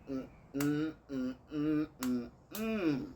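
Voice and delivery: loud, disapproving Chicago hums; Chicago disapproval. Retold or imitated. Chicago side reacting to a rude date, loud disapproving mm-mm. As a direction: shake your head with a hum. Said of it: "the Chicago in me was like".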